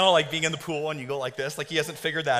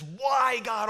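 higher, drawn-out mock plea; plaintive complaint; 7 semitones higher than usual. Believer complaining to God in the storm, plaintive mock wail. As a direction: cry it out as a mock lament.

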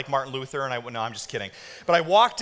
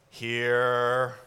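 lower, flat-out bored mumble; bored teen; 6 semitones lower than usual. Retold or imitated. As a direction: drag it out like an unwilling teen. Bored junior high student.

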